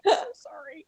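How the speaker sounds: high, whimpering imitation of a guilty mom; small, pretend-crying voice; mock tearful apology. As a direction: whimper it with hammed-up remorse. Acts out mother's tearful apology.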